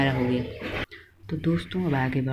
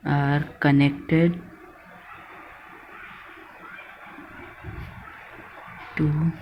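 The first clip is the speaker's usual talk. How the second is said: word by word, long pauses between; firm, spaced-out chunks for note-takers; slow dictation; much slower than usual. Real, not acted. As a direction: dictate slowly while writing it out. Dictating while writing it out.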